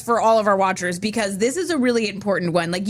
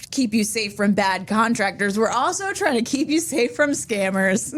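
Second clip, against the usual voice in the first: ordinary talk, nothing marked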